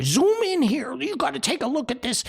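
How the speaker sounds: high, fast, showy weatherman voice; breathless hyped-up forecaster pointing at the snow; dramatic TV weatherman. Retold or imitated. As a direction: ham up an excited local weather guy. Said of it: "he's out there real dramatic".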